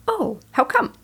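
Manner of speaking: acting naive. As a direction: feign not knowing what she means. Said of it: "I tried to act a little naive".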